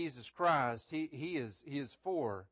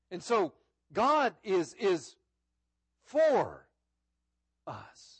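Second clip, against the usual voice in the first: louder and higher, slow preacher's build; fervent preaching; 5 semitones higher, 7 dB louder, slower than usual. Acted. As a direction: preach it out to the congregation. Preaching stress on for again.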